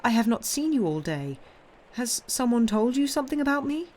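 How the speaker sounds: high, worried granddaughter; concerned and pleading; anxious questioning. A narrator's reading. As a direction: probe urgently, pitch climbing on the question. Granddaughter anxiously seeks the cause.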